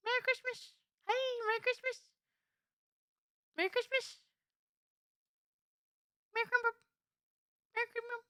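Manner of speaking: high squeaky chirp; high cartoon voice. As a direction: chirp it in a tiny voice. Repeated high-voiced greetings.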